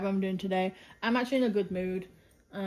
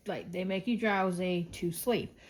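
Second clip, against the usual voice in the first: ordinary talk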